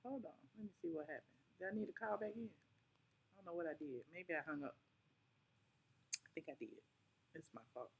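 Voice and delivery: quiet mutter to herself; mumbled under the breath. Real, not acted. Muttering to herself while fixing call.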